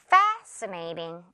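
"Fascinating" as FAAS-uh-nay-ting, one s sound; slow "Fascinating" with a long open FAS; model pronunciation. Shown as a demonstration. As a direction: stretch the first syllable of "Fascinating" wide, then drop low. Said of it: "이렇게 발음되고요".

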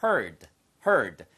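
"heard" said short with a clipped final d; "heard" 두 번, 끝소리 d를 살짝; pronunciation demo. Shown as a demonstration. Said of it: "발음이 heard가 아니고요".

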